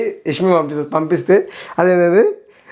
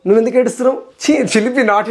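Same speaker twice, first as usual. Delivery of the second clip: mock-coy lover's teasing, pitched higher; 6 semitones higher than usual. Vlogger mocking Nikhil comforting her in a coy lover's voice. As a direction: ham up a bashful sweetheart, slowing on the last words. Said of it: "అన్నట్టు మాట్లాడుతాడు".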